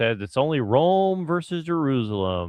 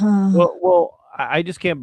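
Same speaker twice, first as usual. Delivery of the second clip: mock shock; 9 semitones higher, 6 dB louder, slower than usual. Exaggerated shocked reaction.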